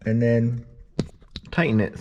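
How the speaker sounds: talking around a flashlight in the mouth. Real, not acted. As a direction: speak with something held between the teeth. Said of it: "flashlight in my mouth".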